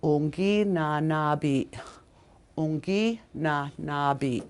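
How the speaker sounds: unginanabi drawn out syllable by syllable; slow careful. Shown as a demonstration. Teacher slowly modeling unginanabi after a stumble. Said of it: "ingu, ing".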